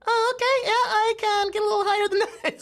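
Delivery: extremely high squeak, breaking into a laugh; like sucking on a helium balloon; sky-high cartoon mushroom voice, nearly laughing; very high Toad voice. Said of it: "kept going up and up and up into the stratosphere".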